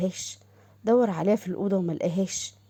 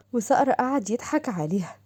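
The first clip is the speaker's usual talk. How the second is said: ordinary delivery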